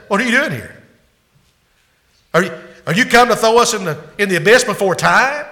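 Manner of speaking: high, fast, frantic demon cry; panicked demon. Acted. Demons panicking before Jesus, high and frantic. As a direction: gabble it in terror. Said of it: "there was utter panic in that demon's voice".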